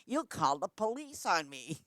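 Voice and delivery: high, loud, backing-off voice; mock nervous refusal. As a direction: imitate a bully suddenly chickening out. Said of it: "She goes".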